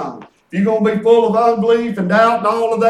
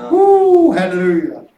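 joyful woo; 5 dB louder, slower than usual. Real, not acted. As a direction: let out an excited woo of praise. Joyful shout during preaching.

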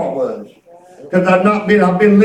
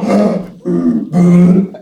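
imitated gasp; 3 semitones lower than usual. Swimmer reaching the top, exaggerated gasp for breath. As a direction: gasp as if breaking the surface.

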